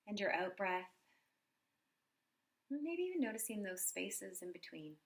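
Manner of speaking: soft, unhurried meditation voice with long pauses; guided meditation. Acted. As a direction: guide them gently through a body scan, quietly. Leads a body scan meditation.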